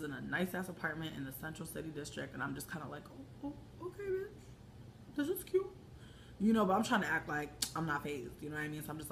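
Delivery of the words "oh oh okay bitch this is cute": high, hushed little coo; quietly impressed. Retold or imitated. Seeing his nice apartment, trying not to look fazed, quietly impressed. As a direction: coo it softly under your breath.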